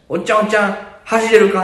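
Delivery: put-on high, excited kid's voice with Kansai wording; be the eager kid asking in Kansai style; high kid's challenge, Kansai flavour; imitated child in Kansai dialect. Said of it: "関西弁やった？".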